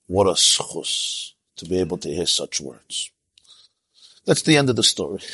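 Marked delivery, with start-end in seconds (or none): 0.1-3.1 whispered, slow and choked with emotion; a tearful whisper of gratitude; hushed tearful awe. Retold or imitated. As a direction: breathe it out as if moved to tears. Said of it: "he was crying as he said it".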